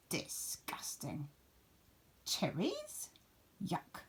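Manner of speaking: whispered rabbit disgust. Acted. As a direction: hiss it with distaste. Rabbits reject the food.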